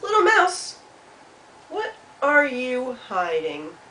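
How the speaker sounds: high call, then slow drawn-out question; curious Hedgehog. Acted. Hedgehog asks Mouse what is hidden, slow and curious. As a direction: call out, then pry slowly.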